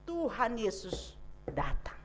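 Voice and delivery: a quiet whispered reveal; hushed whisper. Acted. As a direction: whisper it, breathless with awe. Dramatic reveal of Jesus arriving. (berbisik pelan penuh kekaguman)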